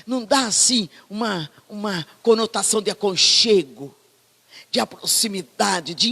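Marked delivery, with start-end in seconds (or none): none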